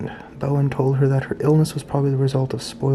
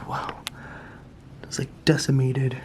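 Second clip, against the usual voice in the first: nervous night vlog whisper; 7 dB quieter than usual. Real, not acted. Whispering over skull.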